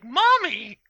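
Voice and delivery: put-on high-pitched toddler calling to mommy; excited little girl. Little girl tells her mommy about a stranger's shirt, high childlike voice. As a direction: imitate a small child piping up, drawn out. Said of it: "this little girl, she's, like, two or three".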